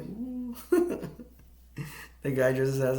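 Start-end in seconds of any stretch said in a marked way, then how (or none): 0.0-0.6 mock ooh. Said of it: "the Santa Claus is like". 0.6-1.2 high giggle. Real, not acted. Laughing at own retelling.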